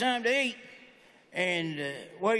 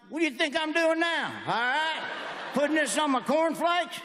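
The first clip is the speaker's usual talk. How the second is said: raised-pitch mock indignation; indignant comeback; 5 semitones higher than usual. Customer answering pet store cashier, mock indignant and high. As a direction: protest in hammed-up disbelief.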